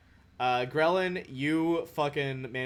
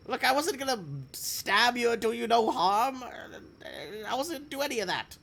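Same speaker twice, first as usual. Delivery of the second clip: nervous goblin pleading; 11 semitones higher, 4 dB louder than usual. Acted. Goblin protests innocence.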